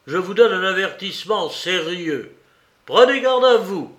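emphatic caution, rising to a loud, high peak; urgent, forceful admonition; stern warning, not murmured. A narrator's reading. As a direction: warn him sharply, building in force. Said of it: "murmura Porphyre".

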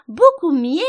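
very loud, high squeal on "Beaucoup mieux". A narrator's reading. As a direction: let "mieux" turn into an animal squeak. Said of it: "sa voix se transformant en un couinement".

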